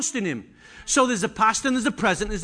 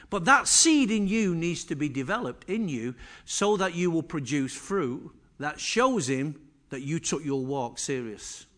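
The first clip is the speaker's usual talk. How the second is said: ordinary delivery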